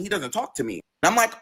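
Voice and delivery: ordinary talk, nothing marked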